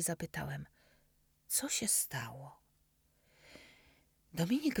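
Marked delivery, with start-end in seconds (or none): 1.5-2.6 slow worried whisper; worried and curious. Acted. Boy quietly asks his friend about the prosthesis, worried whisper. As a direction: ask softly, afraid to pry. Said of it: "Zmartwiony i jednocześnie bardzo ciekawy".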